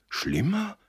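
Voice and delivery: very high, startled question; alarmed cry. A narrator's reading. Josef hearing of worse news, alarmed. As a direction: let alarm push the voice right up. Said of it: "rief Josef erblassend".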